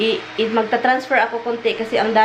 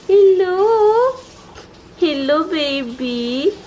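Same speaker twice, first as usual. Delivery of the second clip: high, stretched cooing hello; sing-song greeting to the dog; 7 semitones higher, much slower than usual. Real, not acted. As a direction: coo a hello to the puppy.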